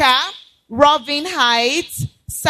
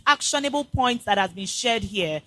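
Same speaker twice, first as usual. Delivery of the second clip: ordinary delivery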